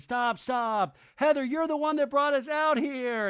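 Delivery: high, loud accusing yell; hammed-up frantic outburst; mock screaming. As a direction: yell it in exaggerated panic. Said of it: "all screaming and making noise".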